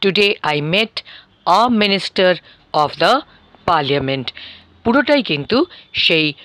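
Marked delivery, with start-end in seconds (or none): 0.0-4.2 slow, careful long form; slow model sentence. Shown as a demonstration. Teacher contrasting long form after short form. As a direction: draw the sentence out. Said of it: "কিন্তু একই লোকের ক্ষেত্রে".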